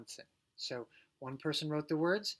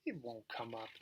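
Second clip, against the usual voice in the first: a hint of a mean brother, softer; lightly voiced unkind brother; 4 semitones lower, 6 dB quieter than usual. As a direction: say it with a mild sneer. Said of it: "He's not being as nice".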